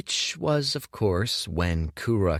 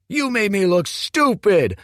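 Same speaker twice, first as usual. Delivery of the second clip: much higher and much louder furious yell; 11 dB louder than usual. A narrator's reading. Humiliated Kuruk yells at Dorian beside his father's grave. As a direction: scream the insult at him. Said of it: "yelled Kuruk".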